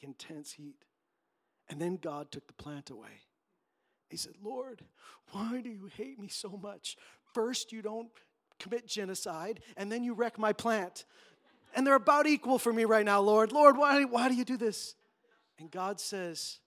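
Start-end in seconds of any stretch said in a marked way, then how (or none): 4.4-11.0 higher, aggrieved whining; whiny complaint. Retold or imitated. Jonah griping to God about his plant, whiny. 11.7-14.9 loud, high, wailing outcry; anguished shout. Retold or imitated. Jonah wailing at God over the lost plant, shouting.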